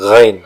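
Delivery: "ghain" thin and hanging in the throat; ghain sound. Shown as a demonstration. Teacher shows students the thin, hanging "ghain". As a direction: let "ghain" float, thin, no hard G. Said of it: "kayak tipis-tipis gantung".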